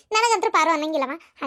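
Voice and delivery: ordinary delivery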